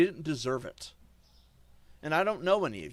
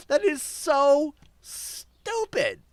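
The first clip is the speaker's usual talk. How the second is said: loud, higher, dragged-out scorn; slow exasperated shout; 9 semitones higher, 6 dB louder, much slower than usual. Real, not acted. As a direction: spit out disgust slowly. Ranting at his chat.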